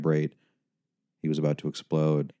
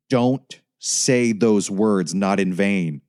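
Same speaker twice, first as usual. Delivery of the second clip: louder, very slow stern snap; snapped; 3 semitones higher, 7 dB louder, much slower than usual. Acted. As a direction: bite off each word in rebuke. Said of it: "Jamie snapped".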